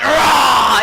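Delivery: loud mock monster roar; big playful "rah" roar. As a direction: roar to scare a child. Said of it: "and I went".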